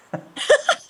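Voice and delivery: high burst of real laughter. Real, not acted. Laughing at the prediction that computer animation would never take off, high laugh. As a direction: laugh at the irony.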